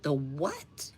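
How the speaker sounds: soft, puzzled query with a sharp rise; hushed incredulous double-take; baffled question. Retold or imitated. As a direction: ask quietly, swooping up on the last word. Same baffled reaction, retold.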